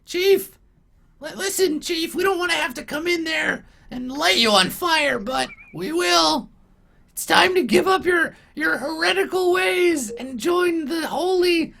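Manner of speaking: very high, shrill goblin squeal, shouting through the door; squeaky goblin. Acted. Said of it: "and he's like".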